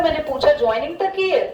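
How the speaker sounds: ordinary delivery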